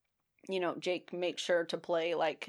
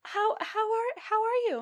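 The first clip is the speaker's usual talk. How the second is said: gentle pharmacist. Retold or imitated. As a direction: coo it like a kind pharmacist. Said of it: "She goes".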